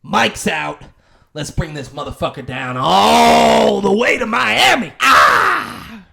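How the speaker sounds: loud, high rallying shout; showman yell. Retold or imitated. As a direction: fire up the crowd. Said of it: "Matthew McConaughey goes".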